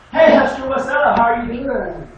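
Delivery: loud, high, fake-friendly hello; mock chummy greeting. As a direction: ham up a student sucking up to the judge. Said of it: "Deontay's like".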